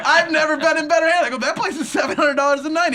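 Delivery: high, loud excited laughing; patient gushing to the anesthesiologist, laughing. Retold or imitated. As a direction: gush about it, laughing.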